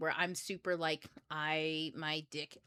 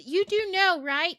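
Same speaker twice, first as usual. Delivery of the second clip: loud, high, pushy lecturing; exaggerated, high-pitched insistence; mock know-it-all; 12 semitones higher, 11 dB louder, slower than usual. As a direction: press the point like a know-it-all. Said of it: "I didn't go around being like".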